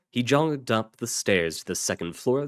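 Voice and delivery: plain reading voice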